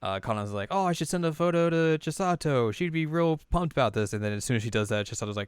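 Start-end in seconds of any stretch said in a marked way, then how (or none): 0.7-4.1 eager idea. Retold or imitated. Kanon has an excited idea.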